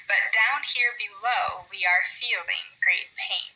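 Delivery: slow galloping read of "I'm Yertle the Turtle"; two light beats before each stressed one; rhythmic reading. Shown as a demonstration. Teacher reads Yertle the Turtle slowly, meter marked. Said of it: "just listen to it and see if it's the same".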